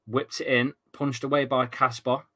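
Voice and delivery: ordinary delivery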